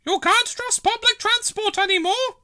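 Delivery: a fussy pensioner complaining; grumbling old lady. Acted. Dillis grumbling at the bus stop, fussy. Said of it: "Dillis grumbled".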